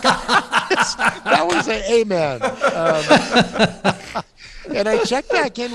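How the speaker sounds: high, laughing quote; bursting into laughter while quoting yourself. Real, not acted. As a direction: laugh your way through the amen. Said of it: "And I'm like".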